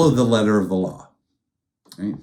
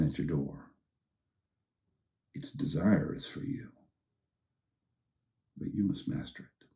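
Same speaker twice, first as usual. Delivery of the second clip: gentle fatherly God; 4 dB quieter than usual. Shown as a demonstration. God gently warning Cain like a loving father. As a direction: speak like a loving dad to his son. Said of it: "still, small voice, like a father".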